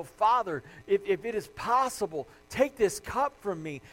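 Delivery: loud, urgent acted plea to God; crying out in prayer. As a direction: cry out to a father, imploring. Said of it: "I think he was crying out to God".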